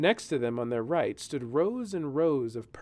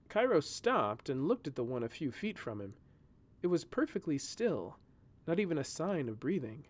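plain reading voice

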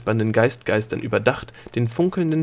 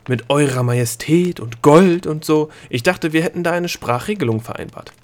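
gruff interruption; 6 semitones higher, 4 dB louder than usual. Acted. Delia scolds the gnome for his sarcasm, gruff and irritated. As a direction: cut him off, annoyed. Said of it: "unterbrach Delia unwirsch".

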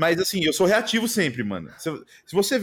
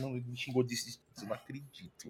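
muttered much softer, hurt and incredulous; hurt disbelief; 3 semitones lower, 15 dB quieter than usual. Retold or imitated. Streamer to his chat after an insult, hushed hurt disbelief. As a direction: drop almost to nothing, stung by the insult.

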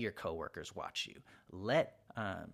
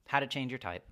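ordinary delivery

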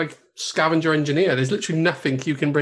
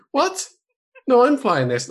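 mock indignant protest; 9 semitones higher than usual. Imagined protest at the table.

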